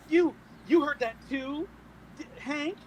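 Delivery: high, scandalised in-character protest; indignant appeal. Acted. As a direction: egg him on, loud and offended at the start. Acting indignant appeal to charmed Hank.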